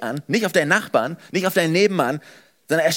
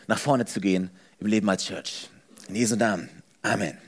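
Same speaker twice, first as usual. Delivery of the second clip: earnest prayer; 4 semitones lower than usual. Real, not acted. Opening prayer. (ernstes Gebet, leiser werdend)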